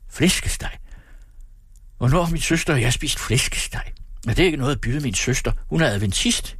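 high, exasperated lament; wailing. A narrator's reading. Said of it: "jamrede han". (jamrende og fortvivlet)

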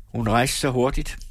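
usual speaking voice

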